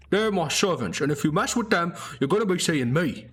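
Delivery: high, loud, faked menace; a small goblin playing the big boss; high loud mock threat; goblin bluffing a threat. Belch threatens the guard.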